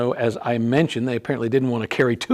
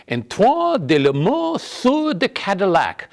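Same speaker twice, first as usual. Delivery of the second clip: slow, higher-pitched amateur French pronunciation; lame French accent; 6 semitones higher, slower than usual. Shown as a demonstration. Host naming Detroit's founder for viewers, in a lame French accent. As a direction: have a go at a grand French name in a hammy French accent. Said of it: "my little lame French accent".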